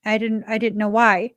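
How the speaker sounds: ordinary talk, nothing marked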